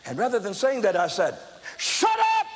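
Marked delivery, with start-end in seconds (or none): 1.8-2.5 very high, loud scream. Retold or imitated. Preacher screaming at the woman to stop, loud. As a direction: blast it out from the belly. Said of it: "And I screamed again".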